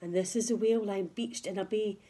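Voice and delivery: ordinary delivery, nothing marked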